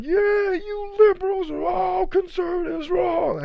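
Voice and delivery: very high strained mock yell; mock screaming. Mimicking people arguing liberals versus conservatives, high mock screaming. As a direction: parody political ranting with a drawn-out high yell. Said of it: "you guys are screaming about".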